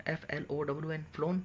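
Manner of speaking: ordinary talk, nothing marked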